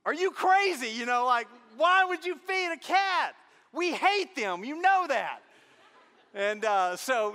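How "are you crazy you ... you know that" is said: high, loud disbelief; husband scolding his wife about the cat; incredulous yell. Retold or imitated. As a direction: shout it at your wife in disbelief. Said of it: "I'm like".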